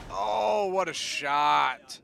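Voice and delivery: loud, drawn-out cry of amazement; loud, slow "what a shot" exclaimed in awe; excited shout. Real, not acted. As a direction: shout it like a caster seeing a huge hit. Caster reacting to a big hit.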